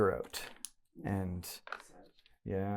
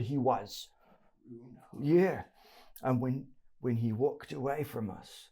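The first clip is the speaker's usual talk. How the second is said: higher-pitched rough-grammar thug voice; thug confessing; 4 semitones higher, 3 dB louder than usual. Acted. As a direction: tell what you saw, still rattled.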